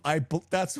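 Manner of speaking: usual speaking voice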